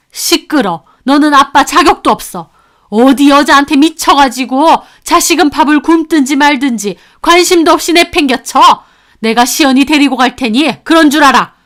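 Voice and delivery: loud, high angry scolding; stern rebuke. Acted. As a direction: cut him down hard. Grandmother rebukes son.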